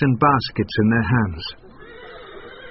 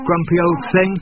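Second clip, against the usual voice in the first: son's voice; 7 semitones higher than usual. A narrator's reading. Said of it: "said the son".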